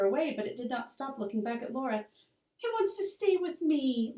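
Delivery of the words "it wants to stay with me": high, slow pleading; begging. Acted. Little Laura begs Pa to let the baby stay, pleading. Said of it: "Laura begged".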